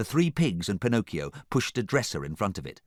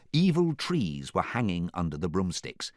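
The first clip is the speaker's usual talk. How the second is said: ordinary delivery, nothing marked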